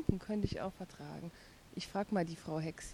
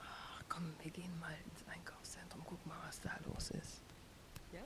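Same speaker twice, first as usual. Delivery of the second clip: conspiratorial whisper; 3 semitones lower, 12 dB quieter than usual. Acted. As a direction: whisper the plan to run away. Sneaking off, plotting quietly.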